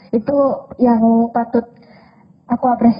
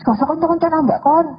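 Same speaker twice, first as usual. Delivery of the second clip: retold angry words; 6 semitones higher than usual. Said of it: "dia omongannya itu bilang kayak gini".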